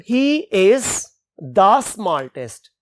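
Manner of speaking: slow, stretched example sentence; reading an example. Shown as a demonstration. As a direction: draw out the example slowly. Example read slowly. (धीमा उदाहरण)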